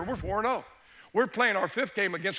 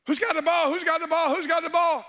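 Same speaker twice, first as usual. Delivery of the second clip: high, loud, insistent calling over the noise; urgent repeated yell; 8 semitones higher, 5 dB louder than usual. Retold or imitated. Coach shouting to the field during a fumble, urgent yell. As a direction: demand it again and again, shouting.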